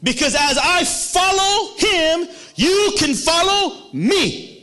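the oldest student calling out to the younger disciples to follow; proud shout. Retold or imitated. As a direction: announce it boldly to the younger ones.

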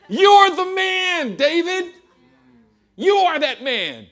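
loud, high, very slow; booming accusation. Acted. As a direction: hammer it home to the king. Nathan's accusation preached loud.

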